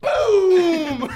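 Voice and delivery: loud, high burst of glee; excited shout. Real, not acted. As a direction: shout it with a laugh. Celebrating beeline.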